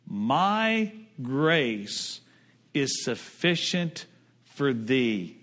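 quiet, slow reassuring answer; gentle divine reply. Retold or imitated. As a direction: speak as God comforting Paul. God's answer quoted.

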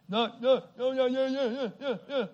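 mock garbled mumble of repeated syllables; imitated tongue-tied mumbling. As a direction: mimic someone who can't get a word out. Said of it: "the man that was mumbling".

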